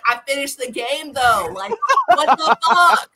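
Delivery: high retold protest, building to a loud cry; exasperated protest. Player retells arguing with fiance over platinum, exasperated protest.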